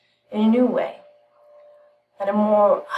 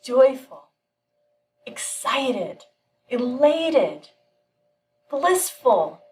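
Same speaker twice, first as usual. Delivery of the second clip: excited lift; 4 semitones higher, much slower than usual. A narrator's reading. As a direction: let the delight bubble up word by word. Narrating the man's joy.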